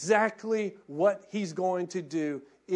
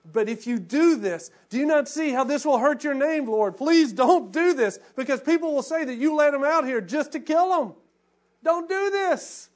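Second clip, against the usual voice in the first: loud, higher pleading plea; 7 semitones higher, 6 dB louder than usual. Retold or imitated. Moses pleading with God not to destroy Israel, loud pleading. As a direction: beg God urgently, voice raised.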